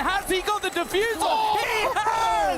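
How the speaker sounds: rising into a shouted esports call; excited shouting. Real, not acted. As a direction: shout the clutch win as it lands. Caster calls a clutch round.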